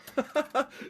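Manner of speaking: short high-pitched laugh; burst of laughter. Real, not acted. Amused by the voice request.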